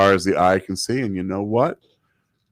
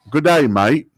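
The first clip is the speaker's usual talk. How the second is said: put-on Australian accent; 7 semitones higher, 4 dB louder than usual. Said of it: "We should speak only in Australian accents".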